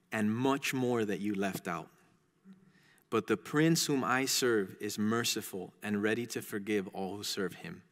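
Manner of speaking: soft, low storybook voice; humble pilgrim's reply. Acted. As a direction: answer the accuser quietly, without fight.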